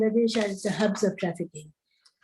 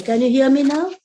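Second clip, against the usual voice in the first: louder, higher, checking they can hear; raised voice; 5 semitones higher, 5 dB louder than usual. Real, not acted. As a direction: speak up over a bad line. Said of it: "my voice is very low".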